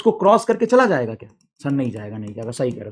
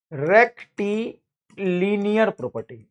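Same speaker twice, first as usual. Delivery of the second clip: dictation; 3 dB quieter, much slower than usual. Shown as a demonstration. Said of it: "dictating term".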